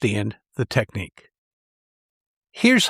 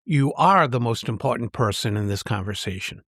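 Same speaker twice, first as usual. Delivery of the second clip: emphatic "are", the rest lower and unstressed; stress on ARE; 3 semitones lower than usual. Shown as a demonstration. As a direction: lean on the word "are", insisting it is true. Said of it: "Now try".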